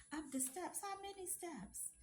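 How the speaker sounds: half-gasp at the thought of stairs; dismayed disbelief. Retold or imitated. Dismayed thought about carrying up steps.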